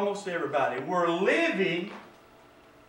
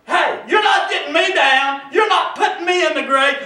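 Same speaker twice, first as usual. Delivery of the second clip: forceful declaration from the pulpit; defiant preaching; 6 semitones higher, 7 dB louder than usual. Real, not acted. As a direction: declare it against the sickness.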